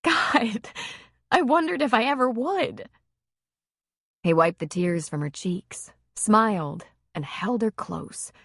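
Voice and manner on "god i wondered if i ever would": higher woman's voice, moved; lightly voiced woman, tender and higher; her voice. A narrator's reading. Female character voice.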